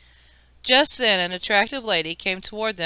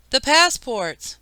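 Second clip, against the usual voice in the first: higher and louder, drawn-out panic; alarmed shout; 5 semitones higher, 4 dB louder, slower than usual. A narrator's reading. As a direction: cry out as milk hits the passports. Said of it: "Peter shouted".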